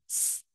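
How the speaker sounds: long whispered hiss of স; hissing s sound. Shown as a demonstration. Teacher hisses স to show the whistling letters. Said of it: "স একটা আওয়াজ আসছে".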